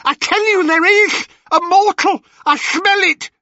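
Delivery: high, loud fast crone cry; crying hag. Acted. Ennio insisting to her sister, high frantic crone cry. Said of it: "cried Ennio".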